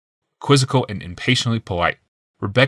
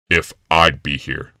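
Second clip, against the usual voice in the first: lower, slow calm man; low man's voice; 5 semitones lower, slower than usual. A narrator's reading. Yawmoth's voice.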